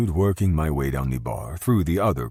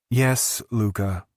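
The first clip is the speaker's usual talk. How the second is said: sassy reply; 8 semitones higher, slower than usual. A narrator's reading. Valentina replies with attitude.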